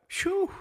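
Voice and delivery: sharp high sigh of relief; relieved phew. Real, not acted. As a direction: blow out a big relieved phew. Relieved after finding the lost letter.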